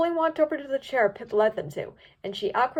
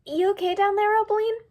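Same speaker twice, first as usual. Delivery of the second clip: high, louder filly voice calling down; Misty's voice; 10 semitones higher, 4 dB louder than usual. A narrator's reading. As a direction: call down from on top, pitched up.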